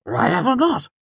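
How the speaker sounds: high excited nobleman; bright, laughing older count; Count Threep voice. A narrator's reading. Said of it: "Threep looked confused".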